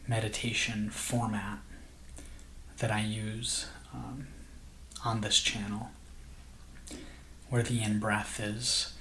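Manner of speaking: soft, slow meditation voice with long pauses; guided meditation. Acted. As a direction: gently guide listeners into calm, unhurried and hushed.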